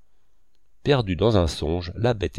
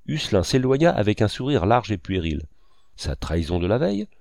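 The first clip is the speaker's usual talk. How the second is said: ordinary delivery, nothing marked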